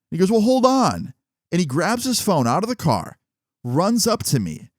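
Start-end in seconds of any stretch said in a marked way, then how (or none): 0.3-1.1 high-pitched imitation of calling someone back; imitated call. Driver calling back his departing passenger, imitated high call. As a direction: call out to halt someone leaving.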